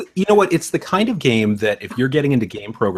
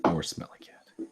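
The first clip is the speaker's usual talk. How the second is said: whisper; 4 semitones lower, 12 dB quieter than usual. Real, not acted. Echoed back in a whisper.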